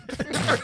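sudden high-pitched laugh; high burst of laughter. Real, not acted. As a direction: crack up high and loud. Burst of laughter after quote.

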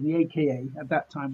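ordinary talk, nothing marked

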